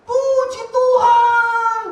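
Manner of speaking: high, loud, long-drawn cry of praise; imitating a drawn-out praise shout; retold shout of praise. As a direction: shout it out high, stretching the last word. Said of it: "Dia teriak".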